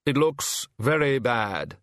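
slow, heavy and worried; slow grave Hogfather. Acted. Hogfather fretting about the situation, slow and grave. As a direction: let the gloom weigh it down.

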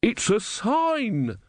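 put-on drawn out, insistent old man; slow stubborn old man; wooden-legged man. A narrator's reading. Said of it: "said the wooden-legged man".

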